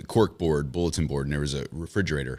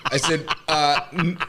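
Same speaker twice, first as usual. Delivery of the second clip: laughing loud and high through the story; cracking up at his own blunder; telling the clubhouse gaffe while cracking up; 11 semitones higher, 4 dB louder than usual. Real, not acted.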